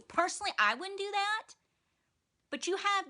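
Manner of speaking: mock-sweet Maggie needling Tara about snitching; mock sweet passive aggression. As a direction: put it sweetly while twisting the knife.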